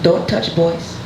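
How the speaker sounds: put-on deliberate Haitian-accented mother, long pauses. Mother warning her daughter about boys, stern Haitian accent. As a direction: lay down rules in a Haitian accent, pausing between each. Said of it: "I'm gonna do the accent".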